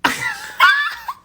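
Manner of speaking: sudden shrieking laugh; high shrieking laugh at own story; high burst of laughter. Real, not acted. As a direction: let out a high squealing laugh.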